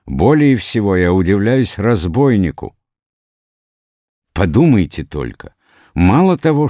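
high, loud, marvelling excitement; rapturous exclaiming. A narrator's reading. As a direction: build to an excited high pitch on the money. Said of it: "с таким восторгом говорит".

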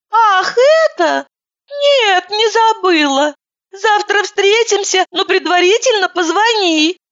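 high, teary, slow phone voice; a weepy woman picking up the phone; tearful high woman. A narrator's reading.

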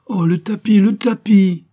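high, loud alarmed cry over the carpet. A narrator's reading. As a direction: wail about the ruined rug in dismay. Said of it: "poussa un cri d'alarme".

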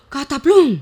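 a big shouted cataplum; booming storybook thud; loud crash cry. Acted. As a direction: bellow the crash as he falls. Horse falls into hole.

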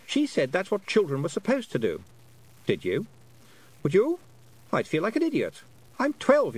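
put-on high boyish voice rising in protest; a twelve-year-old insulted at being told to play; indignant boy Roland. A narrator's reading.

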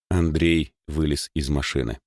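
ordinary delivery

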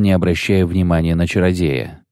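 usual speaking voice

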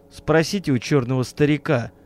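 usual speaking voice

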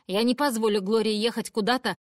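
loud furious mother's tirade; angry shouting. Acted. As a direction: yell it at grandma. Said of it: "кричит мама". (разгневанный крик матери)

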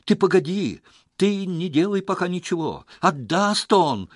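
loud, high plea to wait; pressing, high-pitched loud assurance; urgent shout. A narrator's reading. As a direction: shout to stop him in time. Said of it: "торопливо крикнул Лёха".

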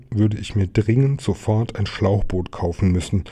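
ordinary delivery

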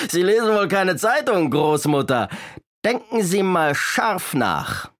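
boastful robber, pitched well up; taunting robber. A narrator's reading. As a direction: sneer at her with hammed-up menace. Robber taunts grandmother after laughing.

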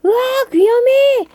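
high shriek of alarm; startled shout. Acted. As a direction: yelp as the rock rolls in. Wolf startled by rolling rock. (깜짝 놀라 외치기)

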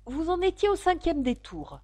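high-pitched, eager-to-please reply; humble. A narrator's reading. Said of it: "dit Alice humblement". (réponse humble, voix aiguë)

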